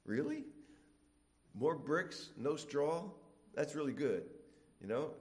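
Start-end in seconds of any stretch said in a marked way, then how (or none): none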